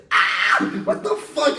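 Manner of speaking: high, loud re-enacted yelp of pain; hammed-up agonized shriek; pained scream. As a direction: scream and curse as if suddenly hurt. Retold pain at catheter going in.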